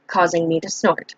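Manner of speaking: ordinary delivery, nothing marked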